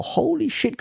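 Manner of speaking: high-pitched disbelief; stunned. Real, not acted. Reacting to the fan calling a YouTuber a dirty copper, shocked high. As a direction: yelp it out in shock. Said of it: "shocked reaction".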